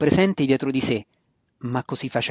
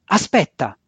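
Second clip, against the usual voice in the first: louder, sudden narrated cry; brief, moderate shout cutting in; shouted interruption; 3 semitones higher, 6 dB louder than usual. A narrator's reading. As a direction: call out to halt someone across the room. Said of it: "gridò Maya dall'altro lato della stanza".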